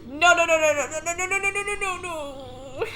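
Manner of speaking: frantic, rising panic; being chased. Real, not acted.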